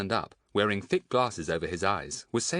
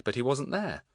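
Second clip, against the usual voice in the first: usual speaking voice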